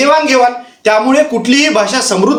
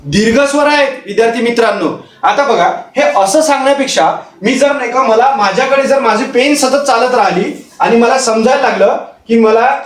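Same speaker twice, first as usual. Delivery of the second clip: usual speaking voice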